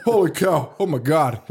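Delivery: mildly hammed-up amazement; astonished moviegoer. Mimicking cult classics regular, mild mock astonishment. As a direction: imitate a clean-mouthed, startled viewer. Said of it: "he doesn't swear. It was like".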